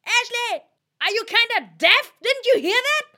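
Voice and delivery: high-pitched, full-volume shouting; loud yelling. Pretend. Yelling at Ashley who seems deaf, very loud. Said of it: "Oh fuck, that was loud".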